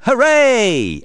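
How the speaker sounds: loud, high, long-held cheer. A narrator's reading. Everyone celebrating the teddy prizes, cheering. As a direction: cheer it out at full voice. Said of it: "Everyone cheers".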